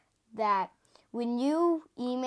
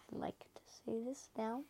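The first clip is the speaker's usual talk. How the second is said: hushed whisper; 11 dB quieter than usual. Shown as a demonstration. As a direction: say it again under your breath. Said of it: "repeats it whispered".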